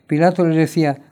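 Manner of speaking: ordinary delivery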